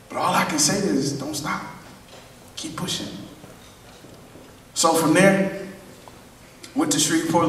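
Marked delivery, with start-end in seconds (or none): 0.1-3.1 soft, fading to a whisper on keep pushing; a crying father's gentle push; hushed, whispered encouragement; tearful hush. Retold or imitated. My dad just started crying.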